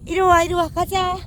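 loud high call; calling the dogs. Real, not acted. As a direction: urge the dogs to come right away.

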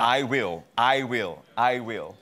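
"I" hit firmly each time; three firm promises, weight on "I"; stress on I. Acted. The Lord promising under grace, firm stress on "I". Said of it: "Grace emphasizes the Lord".

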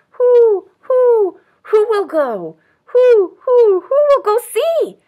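high, loud drawn-out hoots; hooting owl. A narrator's reading. Owl asks animals who will go, hooting. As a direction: hoot the questions like an owl. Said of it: "asked the owl of many questions".

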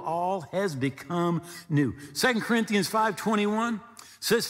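ordinary delivery

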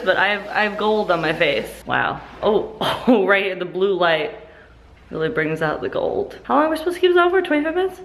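usual speaking voice

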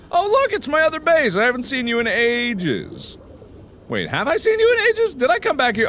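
high, bright mock delight; excited. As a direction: greet it like an old friend. Greeting a base he revisits.

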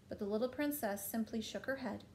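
plain reading voice

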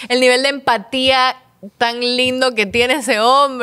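slow, tender marvelling; slow, moved praise; touched admiration. Real, not acted. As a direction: savour every word. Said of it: "admiring the boyfriend".

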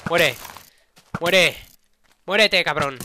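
loud fierce yell; angry shout. Real, not acted. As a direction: snarl it at the enemy.

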